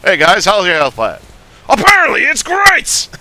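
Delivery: loud, high shouted punchline; character gag. Shown as a demonstration. Doing a character's gag, shouting the answer high. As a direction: bellow the punchline gleefully.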